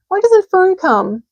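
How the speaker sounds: high, slow, close to tears; a young pig pleading for Fern, slowly; tearful pig. A narrator's reading. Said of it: "He began to cry".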